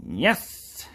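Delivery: sharply rising put-on yes; hunched game guy. Hunched-over man answers the player, rising put-on yes. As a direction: answer with a quick upward swoop. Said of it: "he goes".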